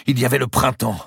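high, loud, pressing delivery; urgent, panting. A narrator's reading. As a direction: press on as if short of breath. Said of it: "d'une voix pressée, haletante".

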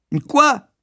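very high, rising disbelieving query; scornful master's yelp; incredulous. Acted. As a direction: pounce on the word.

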